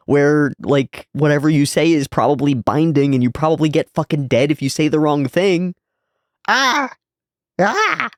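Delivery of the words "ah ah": high squeaky mock yelps. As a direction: yelp in mock alarm. Reacting to the domain.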